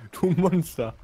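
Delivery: mock outrage. Real, not acted. Mock accusing friend.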